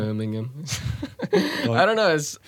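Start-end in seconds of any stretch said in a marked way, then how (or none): 0.0-0.6 lightly imitated Brummie accent; Birmingham accent. Fan practising a favourite word, imitated Birmingham accent. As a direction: try the Peaky Blinders Brummie sound. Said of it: "like that from Peaky Blinders".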